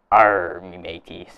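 loud hammy pirate growl. Pretend. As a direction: give it a big pirate arr. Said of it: "Today is National Talk Like a Par-, Pirate Day".

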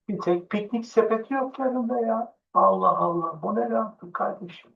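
high, amused teasing aside; mock surprise at the picnic bear; a hint of comic baffle; playful joking. Joking about the cute bear.

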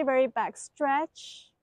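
ordinary talk

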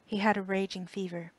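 plain reading voice

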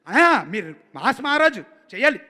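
loud urging. Retold or imitated. Director urging the star to perform, loud and insistent. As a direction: egg the star on loudly.

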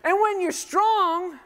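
rally the congregation, full voice; preaching loud. Real, not acted. Pastor urging the crowd to answer, loud and high.

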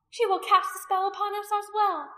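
high, loud alarm; consternation. A narrator's reading. Priestess fearing the woman will speak, in consternation. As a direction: warn them in loud dismay. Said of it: "Anomia said in consternation".

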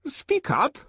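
very high, loud command; high-pitched, peremptory demand; impatient order. A narrator's reading. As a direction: order a dithering boy sharply. Director orders the hesitant boy.

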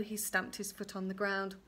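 plain reading voice

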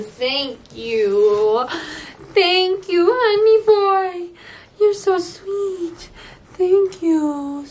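high cooing voice for a puppy. Real, not acted. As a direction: gush over the dog sweetly. Baby talk to the dog.

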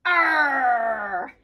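gruff pirate growl; pirate arr. Pretend. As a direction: shout it like a pirate. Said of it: "Got my pirate hat on".

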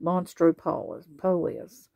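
usual speaking voice

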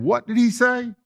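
high, startled disbelief; shocked scribes. Retold or imitated. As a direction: play the scribes, aghast. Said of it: "And they're saying".

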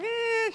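high-pitched squeal of reluctance; whiny "eh". Retold or imitated. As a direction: imitate a balky, high whine. Acts out Naaman refusing to wash.

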